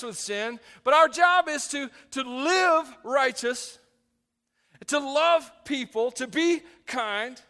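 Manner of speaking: loud, high, emphatic preaching; forceful preaching. Real, not acted.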